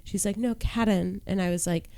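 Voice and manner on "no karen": put-on soft, higher British-accented correction; heavy UK accent. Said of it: "had such heavy accents".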